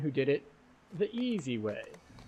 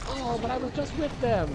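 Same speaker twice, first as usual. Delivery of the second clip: squeaky disbelief, much higher; shocked high voice; 12 semitones higher than usual. Retold or imitated. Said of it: "it's just like".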